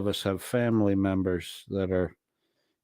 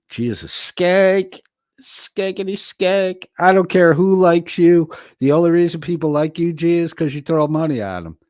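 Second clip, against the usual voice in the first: taunting; 7 semitones higher, 4 dB louder than usual. Acted. Streamer mocking a chatter named Gia.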